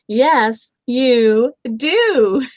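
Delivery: loud playful insisting; Fluffy Bear insisting. A narrator's reading. Bear arguing back and forth, loud and playful. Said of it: "said Fluffy Bear".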